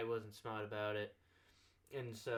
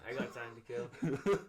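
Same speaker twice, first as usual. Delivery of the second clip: slow, chuckling echo; laughing repeat; 5 dB louder, slower than usual. Retold or imitated. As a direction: laugh through the line. Listener repeats line laughing.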